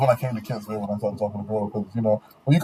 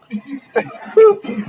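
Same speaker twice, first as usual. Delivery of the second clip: high, loud burst of laughter; 13 semitones higher, 6 dB louder than usual. Real, not acted. Host laughing at his own joke, loud. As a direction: laugh out hard.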